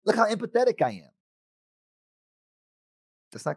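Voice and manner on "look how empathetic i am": high, offended and pleased with himself; mock a friend hurt he's ignored; indignant self-praise. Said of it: "I go".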